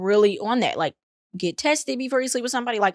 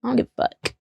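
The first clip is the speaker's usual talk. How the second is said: ordinary delivery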